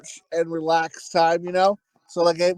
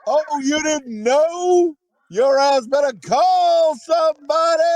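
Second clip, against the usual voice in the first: hammed-up threatening shout; mock threat; 8 semitones higher, slower than usual.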